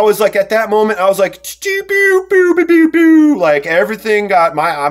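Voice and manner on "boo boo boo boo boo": very high, loud siren-like boops; imitated alarm noise. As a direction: sound off like a panicked alarm. Imitates alarm going off in himself.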